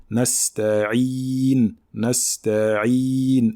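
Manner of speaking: nasta'in with a full long I on the Ayn; full kasra. Shown as a demonstration. Teacher models the complete kasra for students, drawn out. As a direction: ring out the I in nasta'in. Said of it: "un son I complet".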